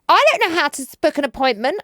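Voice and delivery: put-on high, loud helpless teen whine. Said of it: "She goes".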